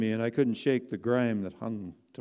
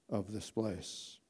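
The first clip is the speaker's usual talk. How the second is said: ordinary delivery, nothing marked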